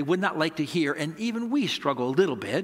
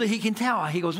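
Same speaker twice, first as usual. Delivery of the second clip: ordinary talk, nothing marked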